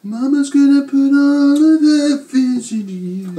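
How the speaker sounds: loud, high mama voice; put-on mama voice. Sudden put-on mother voice.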